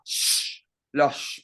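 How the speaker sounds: sh as a long hushing consonant; sh drawn out like a hush; demonstrated letter sound. Shown as a demonstration. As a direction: hold sh as pure hiss, no vowel. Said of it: "para poder pronunciarlo así".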